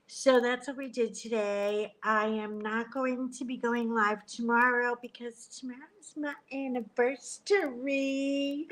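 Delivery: ordinary talk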